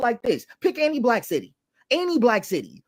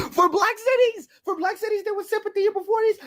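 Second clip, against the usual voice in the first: much higher, still laughing; amused high voice; 12 semitones higher than usual. Real, not acted. Answering a viewer while still amused, high. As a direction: answer through the giggles. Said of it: "still amused".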